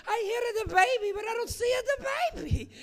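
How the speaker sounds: put-on high, loud old Italian woman; old Italian lady. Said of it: "imagine in her head going".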